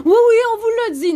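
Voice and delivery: high, loud sing-song mock; mocking staff. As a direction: mimic the dismissive worker, loud and high. Mimics staff brushing her off.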